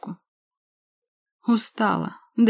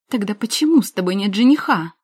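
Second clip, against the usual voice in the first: shrill shriek, much louder; 2 semitones higher, 8 dB louder than usual. A narrator's reading. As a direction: screech it in rage. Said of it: "заверещала Илона".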